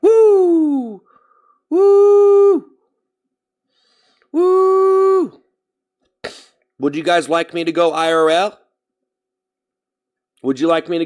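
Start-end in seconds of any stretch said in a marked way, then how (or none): 0.0-5.4 high, loud celebratory woo; excited whoops. Real, not acted. Streamer whooping to chat after a donation, excited high shouts. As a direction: whoop it up like you hit a goal.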